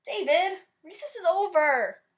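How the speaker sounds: high, loud call across the yard; calling teacher. Acted. Teacher calling David in after recess, high and loud. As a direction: call him in from outside.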